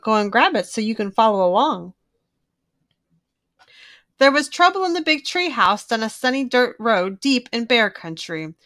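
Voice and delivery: usual speaking voice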